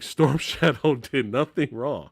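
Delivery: ordinary talk, nothing marked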